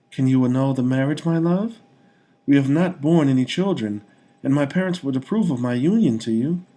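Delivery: high, pleading hopeful plea. A narrator's reading. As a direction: ask with hope rising in her voice. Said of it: "her eyes and tone full of hope".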